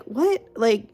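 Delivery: high-pitched "what", then protesting; retold incredulity. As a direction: act out exasperated disbelief. Retold disbelief at underpricing.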